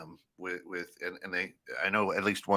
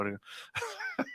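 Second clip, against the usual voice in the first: laughing in disbelief; 10 dB quieter, slower than usual. Real, not acted. Caught off guard by the joke.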